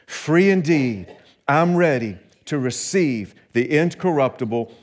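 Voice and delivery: loud, rhythmic declaration of faith; rising confident recitation; group confession. Acted. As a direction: proclaim it boldly with the congregation. Congregation confession recited aloud.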